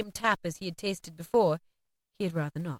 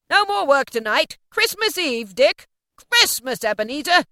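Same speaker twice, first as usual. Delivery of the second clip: high, loud, festive orders; hearty old master, voice raised; jovial cry; 7 semitones higher, 7 dB louder than usual. A narrator's reading. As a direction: call out a holiday to the lads. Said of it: "cried old Fezziwig".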